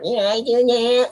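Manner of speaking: high, slow nerdy Urkel voice; lightly voiced nerdy sitcom kid; Urkel impression. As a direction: squeak it out like Urkel. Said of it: "Urkel's right here, like".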